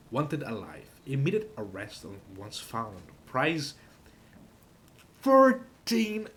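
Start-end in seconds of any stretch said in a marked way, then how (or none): 5.2-6.3 high loud incredulous; can't believe the number; shocked disbelief. Real, not acted. Astonished at the price.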